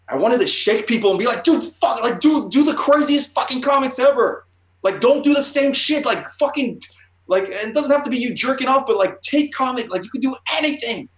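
high-pitched, loud hype yelling; fake wrestling rant. Fired-up artist wanting to shake comics creators, fake wrestling yell. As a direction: yell it like a wrestler cutting a frantic promo. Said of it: "almost like in a fake wrestling way".

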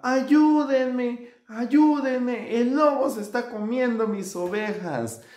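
high-pitched urgent acted plea; shouting for help. A narrator's reading. Shepherd boy with the wolf among his sheep, high desperate cry. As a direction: wail out the alarm. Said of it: "aquel pastorcito gritaba".

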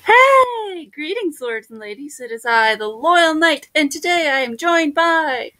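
high, hailing announcer greeting; grand show intro. Acted. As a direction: open the show as a noble knight.

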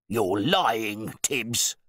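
blustering affront; angry accusation. A narrator's reading. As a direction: accuse the butler in front of everyone. Host accuses butler.

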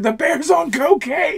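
very high, loud, slow mock shout. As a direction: belt it out like a panicked kid. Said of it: "It's like".

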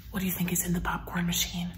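hushed secret whisper; excited storytime whisper. Acted. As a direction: lean in and whisper it fast. Secret-sharing with kids.

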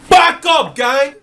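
high, loud startled yell; alarmed shout. Real, not acted. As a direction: shout at friends to back off. Startled, telling friends to retreat.

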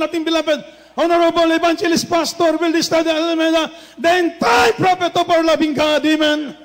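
loud, high praise; preaching at full force. Acted. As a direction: lift the church into praise. Closing praise to congregation.

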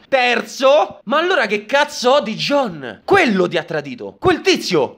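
ordinary delivery

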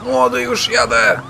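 głośne, wysokie, przeciągnięte wołanie; woła z daleka do młodego, powoli. Acted. Jedzie na pomoc koledze. (głośne wołanie do kolegi)

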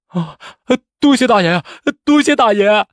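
high, loud wailing gratitude; tearful thanks. A narrator's reading. Desperate candidate clinging to his rescuer, crying. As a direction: cry out your thanks in sobs. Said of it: "哭道".

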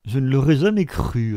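mocking retort to Elizabeth. A narrator's reading. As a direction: scoff at her answer. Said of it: "d'un ton moqueur".

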